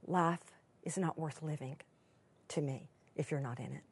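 low, soft loving words; tender. Retold or imitated. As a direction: say it softly to his wife. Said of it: "he will say".

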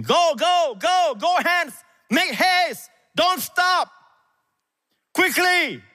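put-on shouting to a boy to hurry off; urgent shouting. A narrator's reading. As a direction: drive the runner on with repeated shouts. Jonathan urging the boy.

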